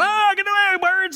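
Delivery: high, loud mock shout; half-comic holler at birds in the yard; shooing the birds. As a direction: yell to scare birds away. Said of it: "I run out of my car going".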